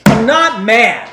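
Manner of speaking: loud, slow I'm not mad, clearly furious; angry denial. Shown as a demonstration. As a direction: bark the words to betray the anger. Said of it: "What do you believe".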